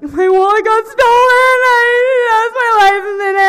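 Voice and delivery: loud, high, hammed-up wail; sobbing. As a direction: bawl the words until they melt into a long cry. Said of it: "I was just sobbing".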